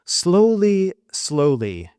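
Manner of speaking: ordinary delivery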